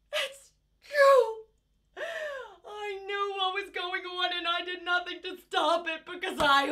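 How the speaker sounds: high, crying, slow breaking voice; sobbing confession. Acted. Mother confessing in court, sobbing and pleading.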